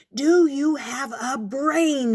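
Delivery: high, slow, loud exasperated yell; the furious ant yells at the fly, rising and loud. A narrator's reading. Ant shaking with rage yells at the fly, slow and loud. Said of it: "he yelled".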